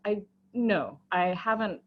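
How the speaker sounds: ordinary delivery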